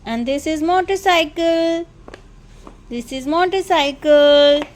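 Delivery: put-on each word drawn out slowly for a toddler; slow teaching voice. Shown as a demonstration. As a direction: spell it out slowly, like teaching a baby. Mother teaching child words.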